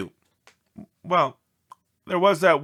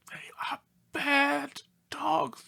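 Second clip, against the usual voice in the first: tearful whisper, a little slow; whimpering whisper; 12 semitones higher, 4 dB quieter than usual. Acted. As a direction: whine it in a whisper like a sad dog. Dog complains in letter.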